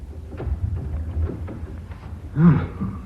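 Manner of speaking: long breathy sigh of relief. Acted. Chairman relieved once Playden leaves, long sigh. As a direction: let the tension out in one long sigh.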